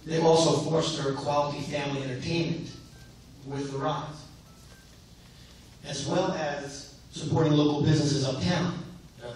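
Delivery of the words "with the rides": usual speaking voice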